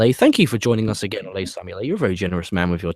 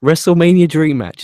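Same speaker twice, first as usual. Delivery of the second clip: louder, higher hype announcer call; 6 semitones higher, 8 dB louder than usual. Pretend. Host hyping a fan's dream match, announcer style. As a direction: sell it like a ring announcer.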